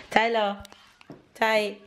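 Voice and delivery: high, loud call across the room. Real, not acted. Mother calling her toddler son to come over, loud high call.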